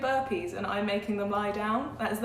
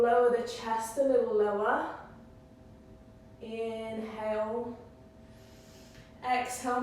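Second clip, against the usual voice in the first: slow, drawn-out yoga teacher voice; unhurried cueing with long pauses; yoga guidance; 2 semitones higher than usual. Real, not acted. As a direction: guide them through each breath, stretching the key words. Guides a yoga breathing practice.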